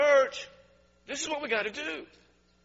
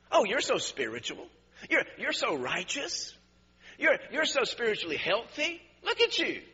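sarcastic; 2 semitones lower, 5 dB quieter than usual. Acted. Preacher voicing Jesus mocking the self-righteous, sarcastic. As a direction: praise them with dripping sarcasm, pitch climbing. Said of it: "it's so sarcastic here".

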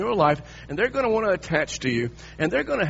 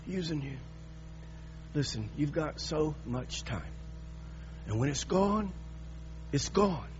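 intimate quiet preaching; 7 semitones lower, 7 dB quieter than usual. Acted. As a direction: draw the congregation in close.

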